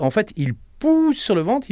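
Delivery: ordinary delivery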